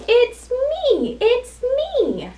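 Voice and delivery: high, sing-song reveal; storybook horse. Acted. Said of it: "says the horse".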